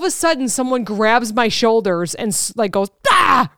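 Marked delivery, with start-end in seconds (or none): 3.1-3.5 mock yell. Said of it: "real loud".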